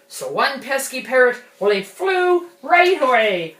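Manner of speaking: loud, high sing-song pirate; hammy pirate voice. Acted. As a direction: belt it out like a pirate captain. Recites pirate rhyme for kids.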